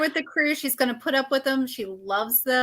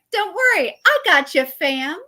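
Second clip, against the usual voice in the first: eager, higher and louder; pumped-up hype; excited; 7 semitones higher, 5 dB louder than usual. Retold or imitated. Boom Boom voiced, eager.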